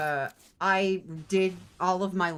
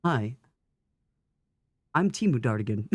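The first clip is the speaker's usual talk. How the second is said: deep knockoff man's voice; Dartigan; 7 semitones lower than usual. Acted. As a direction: play his cheap copy, mock-deep. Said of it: "When you get your Dartigan on Wish".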